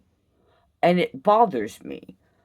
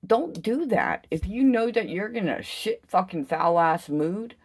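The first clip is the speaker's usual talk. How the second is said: normal talking voice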